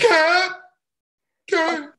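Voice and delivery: high startled yelp then a tearful repeat; shocked. Acted. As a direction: blurt it in sudden horror. Shocked to hear about cat.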